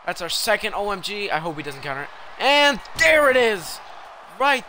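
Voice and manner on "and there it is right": loud, high, drawn-out call; big excited play-by-play climax; triumphant shout. Real, not acted. Table move lands in game.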